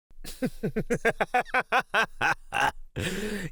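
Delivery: long, high staccato laugh; villainous cackle. Acted. Creature laughing at the frozen fleshling, long high cackle. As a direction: cackle gleefully at frightened prey.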